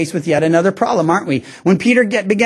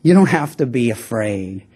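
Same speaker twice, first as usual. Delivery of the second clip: gentle reassurance; 9 semitones lower, slower than usual. Retold or imitated. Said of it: "he just says".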